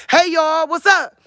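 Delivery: high, lively hey y'all greeting; bubbly and bold, like hollering at friends; my country way of talking. Shown as a demonstration. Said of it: "That's the way I talk".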